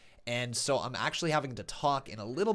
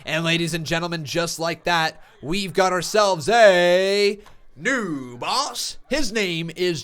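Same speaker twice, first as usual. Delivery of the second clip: slow, drawn-out, louder crowning call; hype announcer; 4 semitones higher, 10 dB louder, slower than usual. Acted. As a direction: stretch it like a ring announcer. Hyped announcer crowning winner.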